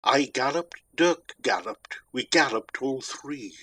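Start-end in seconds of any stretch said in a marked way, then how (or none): none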